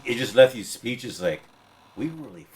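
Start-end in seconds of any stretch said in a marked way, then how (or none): 1.9-2.5 dropped to a near whisper, guiltily; hushed admission. Real, not acted. To his friend about America and Vietnam, a hushed guilty aside. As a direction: say it under your breath like a confession.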